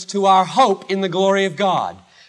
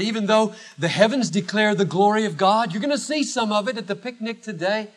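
ordinary delivery

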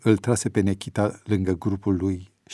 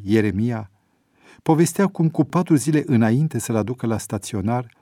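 ordinary delivery

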